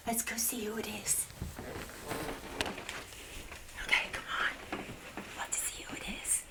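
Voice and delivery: hushed mock-scared whisper; tiptoeing to see who made the noise, whispering. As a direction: sneak toward the door, whispering.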